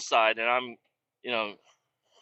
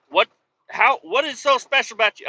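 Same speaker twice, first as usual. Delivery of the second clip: high, loud mock astonishment; incredulous questioning in a raised voice; imitated amazement; 10 semitones higher, 6 dB louder than usual. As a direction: play the baffled coworkers, pitched up. Acts amazed coworkers questioning him.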